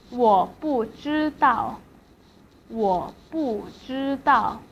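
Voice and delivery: slow, with 不 falling before 知道; fourth tone on 不. Shown as a demonstration. Teacher giving learners an example, slow falling tone on 不. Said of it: "不 as the fourth tone".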